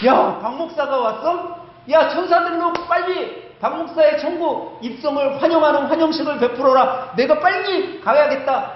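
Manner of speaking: high, eager and delighted; God can't wait to rush out; excited. Acted. God thrilled by pastor's arrival. (신나서 들뜬 하나님 목소리)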